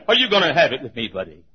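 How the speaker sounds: loud, quick threat falling away at the end; a bully's rapid challenge, lightly hammed; big tyrant. Preacher acting a tyrant challenging someone, threatening. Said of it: "like a big tyrant and say".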